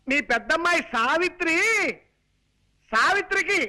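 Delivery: high-pitched, sustained announcing voice; shouting to be heard. Acted. Son-in-law giving deaf aunt wedding news, high shouting. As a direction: belt out the news phrase by phrase for deaf ears. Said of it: "మామూలుగా చెప్పొచ్చు కదా".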